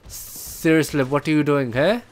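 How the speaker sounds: ordinary talk, nothing marked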